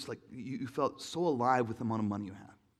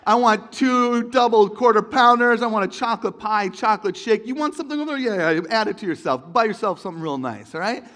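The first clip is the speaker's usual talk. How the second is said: big shot; 6 semitones higher, 11 dB louder than usual. Retold or imitated. Teen splurging at Burger King, loud swaggering order. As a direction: order like you own the place.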